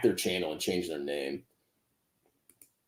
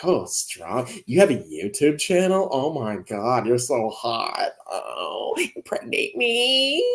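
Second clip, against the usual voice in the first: swooning fan; 3 dB quieter, much slower than usual. Pretend. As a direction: gush like an infatuated admirer, drawn out. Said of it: "I'm like".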